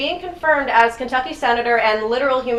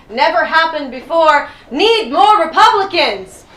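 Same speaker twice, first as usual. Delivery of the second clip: rising to a louder, higher yell; mock tweet yell; 5 semitones higher, 5 dB louder, slower than usual. Comic reads Trump's tweet to the crowd, mock yelling. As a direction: read the tweet out building to a mock shout. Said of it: "yelled the phrase".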